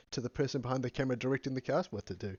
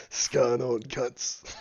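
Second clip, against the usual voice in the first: deep Australian Witcher voice; 7 dB louder, much slower than usual. Pretend. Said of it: "in his deep Australian Witcher voice".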